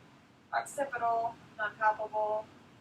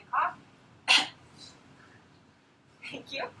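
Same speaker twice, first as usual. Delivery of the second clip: ordinary delivery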